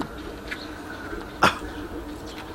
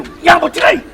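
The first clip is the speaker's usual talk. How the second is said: high and louder, pressing hard; high loud plea; 12 semitones higher, 7 dB louder than usual. Acted. Relative pleading with the nephew, high loud plea. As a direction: cry out for him to be patient. Urging patience in quarrel.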